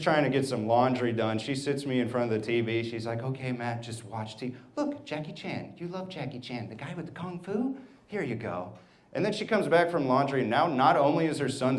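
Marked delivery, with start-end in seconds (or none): 3.2-8.7 softer imitation of a mom coaxing her kid; hushed, sing-song parent voice; busy mom placating. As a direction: coax the child quietly, lifting at the end. Mom settling child before TV.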